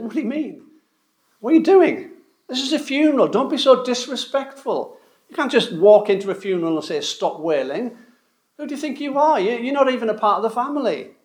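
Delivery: high, sharp voice of offended mourners; indignant scolding. Retold or imitated. Mourners rebuking Jesus at the funeral: high indignant scolding.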